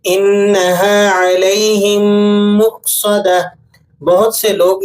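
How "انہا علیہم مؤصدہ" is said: slow melodic tilawat; Quran recitation. Shown as a demonstration. Teacher recites the verse, slow melodic recitation. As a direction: chant انہا علیہم مؤصدہ slowly.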